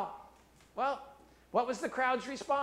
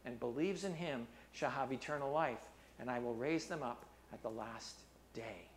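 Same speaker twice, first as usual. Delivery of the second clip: measured preaching read of Jesus' words; scripture reading; 7 semitones lower than usual. Acted. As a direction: read the passage with gravity.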